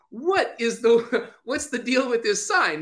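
ordinary talk

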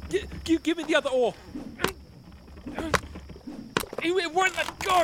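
stuttering high panic; frantic and breathless; panicked. Acted. Boat attacked.

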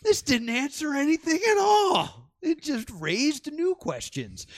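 high-pitched, drawn-out comic indignation; mock outrage. Moviegoer retelling the moment it ended, mock outrage. Acted outraged reaction to film ending.

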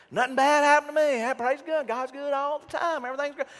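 high-pitched hammed-up positivity, loud at first; mock cheerful churchgoer. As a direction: parody relentless cheer way up high. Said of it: "you only get with people that".

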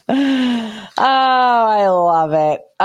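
loud, drawn-out gleeful crow; delighted. Real, not acted. Host gleefully relishing the irony, loud and drawn out. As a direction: savor every word with delight. Laughing at the news script.

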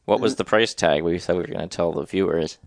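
ordinary talk, nothing marked

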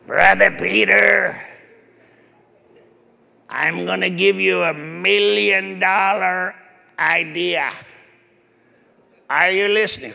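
big, slow, booming preacher voice; Morris Cerullo impression. Old preacher friend promises Peter an idea, booming imitation. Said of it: "he had this voice".

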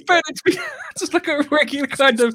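high voice still full of laughter; real laughing. Real, not acted. Recovering after telling a favourite sketch, laughing. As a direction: talk on through the tail of a giggling fit.

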